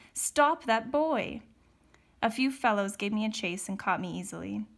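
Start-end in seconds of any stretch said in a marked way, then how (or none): 0.1-1.4 slow, raised yell. A narrator's reading. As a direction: shout to halt a runaway. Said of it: "yelled Trumbull".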